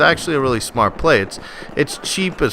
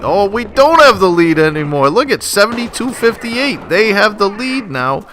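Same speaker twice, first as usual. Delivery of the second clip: high, loud, incredulous outburst; alarmed realization, voice jumping high; dismayed surprise; 9 semitones higher, 4 dB louder than usual. Real, not acted. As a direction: protest the lost lead, pitched way up. Sees the team lost the lead.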